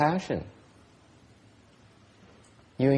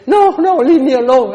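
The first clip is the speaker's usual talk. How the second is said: much higher and louder whining refusal; mock whiny refusal; 11 semitones higher, 14 dB louder than usual. Teacher mimicking a student who refuses help, whiny. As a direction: play the pushy brat waving help away. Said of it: "and say".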